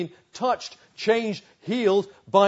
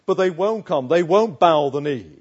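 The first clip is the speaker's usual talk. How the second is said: louder emphatic refusal; forceful; 8 dB louder than usual. Real, not acted. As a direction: stress won't hard. Emphatic climax.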